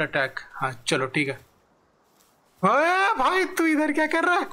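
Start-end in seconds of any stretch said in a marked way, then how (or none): 2.6-4.4 high-pitched, startled challenge; indignant calling out. Real, not acted. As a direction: call out sharply to someone doing the wrong thing. Yelling at creature in game. (ऊँची आवाज़ में टोकते हुए)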